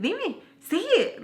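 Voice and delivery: high, drawn-out dare; mock goading. She dares the teller to say it, mock goading. Retold challenge to speak up.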